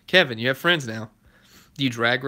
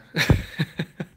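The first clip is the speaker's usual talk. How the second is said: laugh; 4 semitones higher than usual. Real, not acted. Reacts to chat shout-out.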